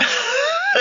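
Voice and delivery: high, rising burst of laughter; amused laugh. Real, not acted. Storyteller after firing off his booms, real high laugh. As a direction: burst out laughing after your sound effects.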